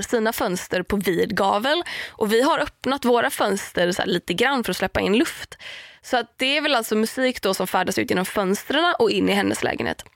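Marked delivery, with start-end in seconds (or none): none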